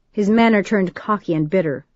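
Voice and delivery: usual speaking voice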